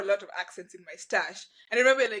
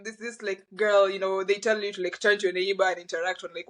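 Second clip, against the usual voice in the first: normal talking voice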